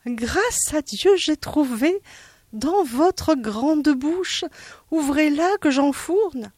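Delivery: high, slow, gleeful character voice; relieved idea voiced high. Monsieur Dard telling Madame Vazedoux to open her mouth, high gleeful voice.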